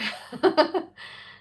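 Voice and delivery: breathy high giggle; airy laugh. Real, not acted. Confused by chat message.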